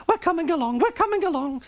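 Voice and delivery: very high squeaky coach voice; coaches' voice. A narrator's reading. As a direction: answer in the coaches' tiny high voice. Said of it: "said Annie and Clarabel".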